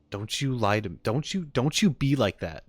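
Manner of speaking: ordinary delivery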